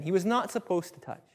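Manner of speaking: ordinary talk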